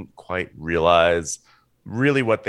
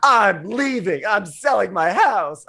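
high, drawn-out theatrical yell; mock yelling; 11 semitones higher, slower than usual. Imitating the movie character's tirade at Betsy Branch, mock yelling. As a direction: imitate a dramatic outburst, higher and slow. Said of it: "her just yelling at Betsy Branch".

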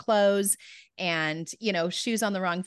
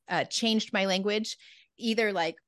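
ordinary talk, nothing marked

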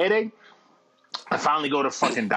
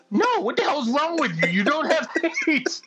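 exasperated doctor; 12 semitones higher than usual. Retold or imitated.

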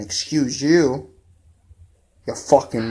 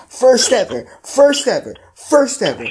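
each FIRST pitched higher and punched; rising, insistent emphasis on First ever; emphatic repetition; 9 semitones higher, 3 dB louder than usual. Real, not acted. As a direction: hammer every First ever louder. Hammering home Brady's unanimous MVP.

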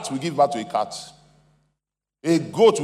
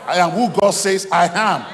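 louder, higher proclamation; forceful pulpit affirmation; emphatic declaration; 4 semitones higher, 6 dB louder than usual. Shown as a demonstration. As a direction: declare it with full conviction, louder. Declaration repeated with more force.

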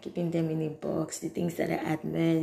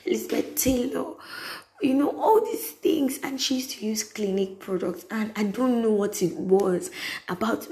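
ordinary talk

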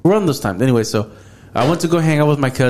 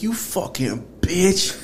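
retold slow, drawn-out curse, softer than talk; understated anger, stretching the insult out slowly; mad at cousin; 4 semitones higher, 5 dB quieter, much slower than usual. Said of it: "I was all mad at my cousin".

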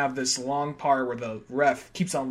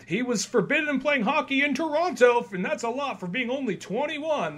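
mock announcer; 10 semitones higher than usual. Announcer introducing goon.

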